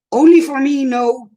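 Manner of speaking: loud high playful cry; teasing herself loudly; high playful exclaim. Real, not acted. Laughing at herself.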